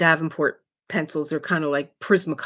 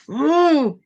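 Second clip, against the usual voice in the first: distressed whimper; 9 semitones higher, 7 dB louder than usual. Real, not acted. Can't remember band's name.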